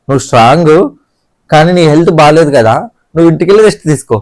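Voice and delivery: hammed-up, loud, fast retelling of the reason; mock Suman advice. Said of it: "reason Suman అన్న చెప్పింది". (సుమన్ అన్న సలహాను గట్టిగా వేగంగా వెక్కిరింపు)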